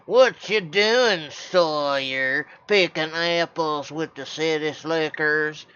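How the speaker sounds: mocking Southern bully voice; Southern accent. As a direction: taunt his brother in a slow Southern drawl. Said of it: "I'm gonna have an accent like this".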